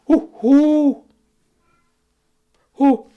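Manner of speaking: high, loud cooing hoo hoo, cut off; wood pigeon imitation. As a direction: coo like a wood pigeon that finally finishes. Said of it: "and he goes".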